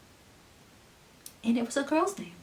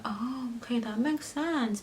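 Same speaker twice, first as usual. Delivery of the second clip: whispered reply; 3 semitones higher, 9 dB quieter, slower than usual. Retold or imitated.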